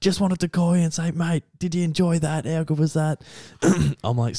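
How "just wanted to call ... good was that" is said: excited caller. Retold or imitated. Retells footballer's call from noisy rooms.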